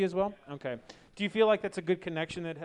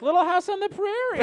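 mock gushing quote; 12 semitones higher, 3 dB louder than usual. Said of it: "You're like".

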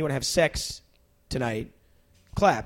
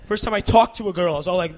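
ordinary delivery

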